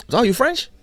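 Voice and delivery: high, loud, eager question; imitated excited stranger. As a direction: pounce on the discovery with delight. Acting the man's excited greeting.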